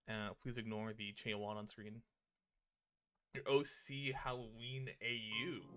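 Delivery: normal talking voice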